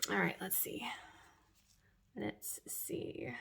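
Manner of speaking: soft whisper to herself. Real, not acted. Checking her brows, whispering. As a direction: mutter while inspecting.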